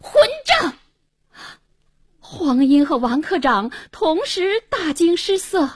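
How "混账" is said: high, explosive angry shout; one sudden, furious yell; thundering rebuke. A narrator's reading. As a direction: bellow an insult in fury. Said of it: "大喝一声".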